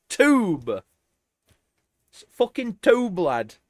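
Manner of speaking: own British accent. Shown as a demonstration. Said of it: "It's not an accent".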